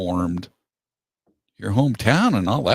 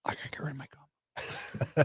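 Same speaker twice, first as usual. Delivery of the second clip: whispered through a laugh; giggly whispered aside; 6 semitones higher, 7 dB quieter than usual. Real, not acted.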